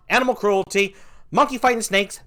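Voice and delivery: normal talking voice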